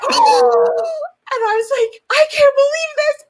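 high, loud shocked cry; reliving the shock at full pitch; squealing retold horror; gasping disbelief. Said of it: "I was flipping out".

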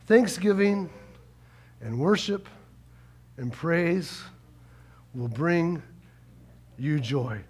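hushed, measured sermon close; soft lowering close. Real, not acted. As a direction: let it sink down gently. Slow soft closing point.

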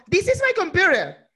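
loud, high, slow declaration; mock defensiveness. Traveler opening her bag for the gringo, loudly emphatic.

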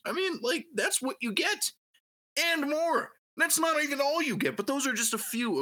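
high, hyped sales pitch; excited. Acted. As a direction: sell it like an infomercial. Hyping the paid plan.

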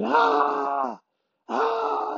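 high, drawn-out mock scream; Jon Jones. Fighter imitating Jon Jones greeting him, screaming. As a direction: imitate a wild, high-pitched holler. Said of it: "Jon Jones was screaming".

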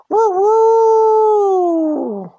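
a high, loud celebratory woo-woo; cheering that the restaurants are open, high loud whoop; excited whoop. Real, not acted. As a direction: whoop like the crowd just cheered.

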